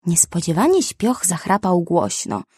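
ordinary delivery

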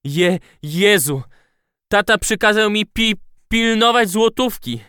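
high, panicky stammer; stammering groan. A narrator's reading. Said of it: "jęknął Żyrafa".

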